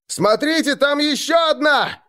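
very high alarmed yell; shouting. A narrator's reading. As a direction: cry out in panic to everyone. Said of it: "закричали охотники".

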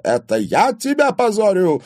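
explosive high, loud outburst; enraged yell. A narrator's reading. Father erupts at his daughter, yelling. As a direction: explode at the accusation. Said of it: "завопил он так".

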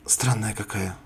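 softly puzzled; quiet. Acted. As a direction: say it quietly under the breath. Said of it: "сказала Сара".

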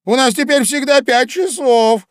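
high, loud, excited Hatter; a lively know-all Hatter; loud, high, bustling Hatter. A narrator's reading. Hatter continues.

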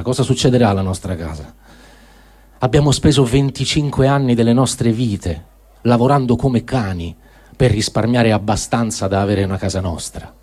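ordinary talk

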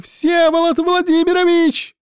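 Всеволод Владимирович screamed high and loud, stretched out; shouting his name. A narrator's reading. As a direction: call Всеволод Владимирович at the top of the voice. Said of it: "закричала Вика".

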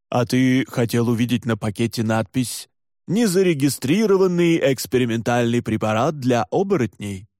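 irritated doctor's retort in an altered voice; irritated sarcasm. A narrator's reading. Doctor rebukes Tuomas over the drip label, irritated sarcasm. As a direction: throw the question back with irritation. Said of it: "Доктор раздражённо фыркнул".

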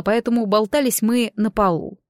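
usual speaking voice

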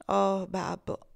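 slow, sorrowful sigh of a call; sad. A narrator's reading. Eva sadly answering her father, slow and sorrowful. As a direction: let it ache, slowly. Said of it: "esclamò Evangelina tristemente".

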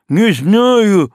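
mumbled through a gag; loud gagged protest. A narrator's reading. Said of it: "мычит".

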